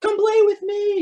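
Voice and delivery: very high sing-out taunt; mock yelling. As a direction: imitate a haunt actor's empty yell. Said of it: "yelling, like, just to yell".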